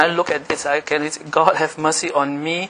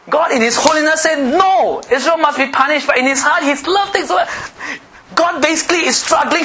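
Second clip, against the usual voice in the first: high, loud, fervent pulpit voice; impassioned sermon delivery; preaching at full force; 10 semitones higher, 4 dB louder than usual. Acted. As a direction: preach it out to the whole hall.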